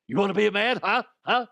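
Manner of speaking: loud, high, hammed-up bark; mock tough-guy challenge. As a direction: get in their face, mock drill-coach yelling. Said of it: "No, he didn't say that".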